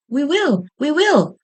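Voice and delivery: high eager chorus; chorus call. A narrator's reading. Vegetables answering Pumpkin in chorus, high and eager. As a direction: call it back together like a group. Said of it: "called the vegetables in chorus".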